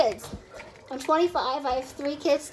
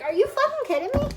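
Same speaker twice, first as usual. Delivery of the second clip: incredulous outrage; 10 semitones higher than usual. Retold or imitated. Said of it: "I'm just like".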